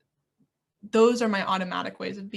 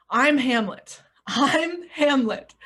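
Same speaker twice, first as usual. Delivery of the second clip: mock grand declaration; 6 semitones higher, 7 dB louder, slower than usual. Insisting he is Hamlet.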